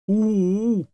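high, loud hooting whine; dog howl. A narrator's reading. Dog unable to answer his owner, howling. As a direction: howl a wordless reply.

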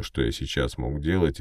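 ordinary delivery, nothing marked

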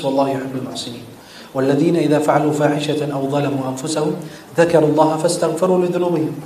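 fast murmured recitation of verses; quick low recitation of Ali Imran; rapid Quran recitation. Acted. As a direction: rattle through the Arabic verses quickly.

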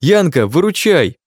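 high, loud, slow panicked wail; hysterical scream. A narrator's reading. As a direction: near shriek for help. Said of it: "завопил я, едва не визжа, как истеричка".

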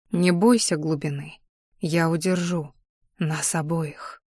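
soft, low, slowing voice; calm them gently, almost under your breath; quiet reassurance. A narrator's reading. Man holds the girl up in deep water, quiet reassurance. Rescuer calms the drowning girl.

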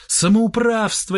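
high, drawn-out indignant squeal. A narrator's reading. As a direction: stretch the word in high outrage. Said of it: "визжал он". (возмущённый визг с растяжкой)

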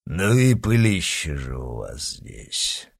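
low, slow monster voice; alien overlord. A narrator's reading. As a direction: rumble it like a beast. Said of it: "довольно прорычал пришелец". (низкий неспешный голос чудовища)

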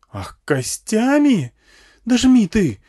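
very high, loud, insulted outburst; stung, indignant shriek; offended woman. A narrator's reading. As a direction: take offence shrilly, then order the driver on. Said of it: "Оскорблённая женщина".

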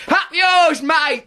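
high, loud mock yell of "Up yours, mate"; rude shout. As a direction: bark it like a cheeky lout. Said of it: "your fist goes upwards".

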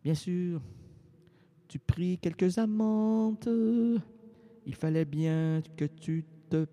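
slow, measured poetic reading; poem recitation. Acted. Récite un poème chanté. (récitation poétique lente)

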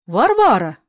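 loud, reproving call of a name; warning cry. A narrator's reading. Grandmother warning her daughter by name, loud and reproving. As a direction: call her sharply to order.

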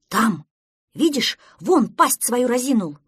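loud, urgent pointing-out; alarmed shout. Acted. Prostodursen shows duckling the lion, loud alarm.

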